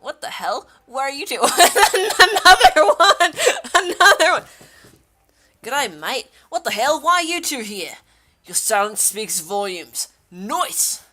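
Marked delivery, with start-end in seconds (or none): 1.4-4.4 loud, high cry through laughter; real incredulous laughing yell; laughing shout. Real, not acted. Startled laughing at new arrival. 5.6-11.0 put-on Aussie accent; playful broad Australian accent. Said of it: "In an Australian accent".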